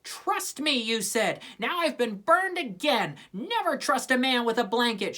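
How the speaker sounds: fuming. A narrator's reading. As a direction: vent your betrayal. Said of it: "she fumed".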